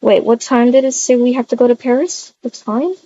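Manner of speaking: ordinary talk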